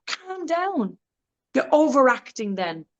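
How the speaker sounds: ordinary talk, nothing marked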